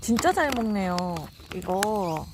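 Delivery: normal talking voice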